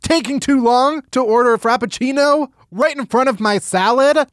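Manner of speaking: outraged quote. Retold or imitated. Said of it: "you say".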